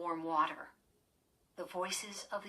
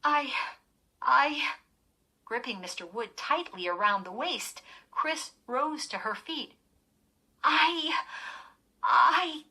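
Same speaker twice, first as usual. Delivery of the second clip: choked stammer; 7 semitones higher, 9 dB louder than usual. Acted. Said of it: "she tried to utter an apology".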